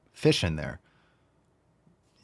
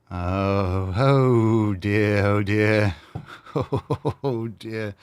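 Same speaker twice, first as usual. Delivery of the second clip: a hint of tut-tutting dismay; lightly voiced fussy old man; much slower than usual. Mock reaction to name.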